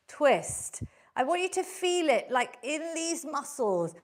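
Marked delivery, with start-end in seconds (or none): 0.1-0.8 exhaling through it. Shown as a demonstration. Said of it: "breathe out".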